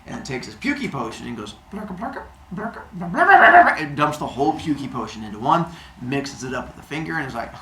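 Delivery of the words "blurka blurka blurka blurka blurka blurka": glugging sound, swelling at the end; pouring noise. Acted. Zeus dumps the potion in, glugging noises. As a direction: pour the potion in with glugs.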